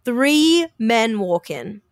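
drawn-out, slow dramatic reveal; suspenseful slow reveal. Real, not acted. Telling her co-host three men walked in, slow suspense. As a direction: stretch each word for suspense.

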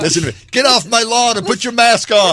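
put-on high, loud cranky old grouch; cranky hermit. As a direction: yell it like a grumpy old man. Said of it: "he sounds like some cranky hermit".

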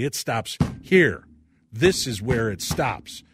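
slow, emphatic declaration; drawing the line. Acted. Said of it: "draw the line in the sand and say".

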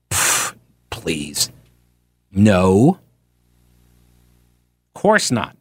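low, dismissive scoff. Real, not acted. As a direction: brush it off with contempt. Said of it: "scoffing, deep dismissal".

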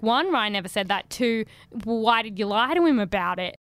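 ordinary delivery

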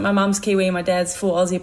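ordinary talk

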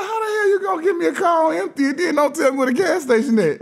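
retold angry yell, high and strained; angry outburst. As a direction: rant at your bro for the empty tank. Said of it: "I was hot".

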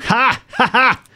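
loud, high, pointed "ha ha"; mocking laugh. Host gloating at rainy San Diego, mocking laugh. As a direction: crow it as a fake laugh.

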